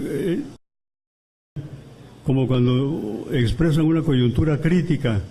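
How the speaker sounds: normal talking voice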